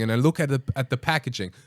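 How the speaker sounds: usual speaking voice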